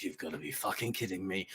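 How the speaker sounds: exasperated knight. Acted. Sir Rory drawing his sword on bandits, low exasperation. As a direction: grumble it under your breath, fed up.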